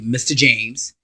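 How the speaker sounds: ordinary talk, nothing marked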